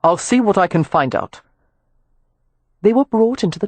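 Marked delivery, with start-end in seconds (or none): 0.0-1.4 a hint of a smooth male voice; lightly voiced Rowan. Rowan greeting Amelia, lightly voiced courteous man.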